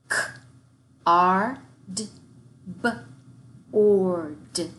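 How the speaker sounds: "Cardboard" broken into separate sounds with pauses; segmented sounds. Shown as a demonstration. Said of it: "start our warm-up by segmenting".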